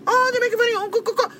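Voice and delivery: high, whining mockery breaking into laughter; mock whiny victim. Mocking a stranger who claims victimhood, high laughing whine. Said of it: "It was like".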